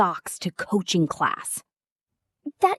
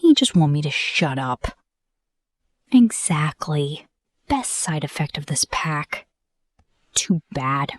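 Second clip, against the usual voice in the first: usual speaking voice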